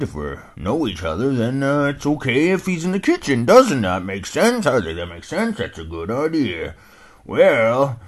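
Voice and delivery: put-on puzzled old dog grumbling; old hound dog. Old hound dog puzzling over the name, loud and folksy.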